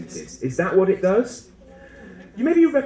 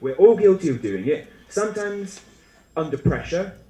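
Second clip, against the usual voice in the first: usual speaking voice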